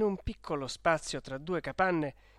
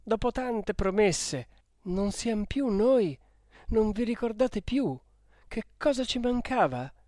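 wounded appeal, pitch raised; pained reproach; 6 semitones higher, 4 dB louder than usual. A narrator's reading. Renzo recalling their promises to Lucia, pained and reproachful.

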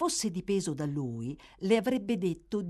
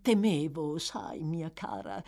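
hesitant start, climbing into a higher wheedle; 5 semitones higher than usual. Acted. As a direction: soothe and needle, old schemer.

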